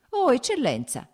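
loud, high happy cry of greeting; shouted greeting. A narrator's reading. Oblonsky hails Vronsky on the station steps, shouting. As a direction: call out to a friend spotted on the station steps. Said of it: "gridò Oblonsky".

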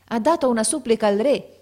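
loud, high bystander's claim; speaking up. A narrator's reading. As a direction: raise the voice above the others. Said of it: "disse uno più forte".

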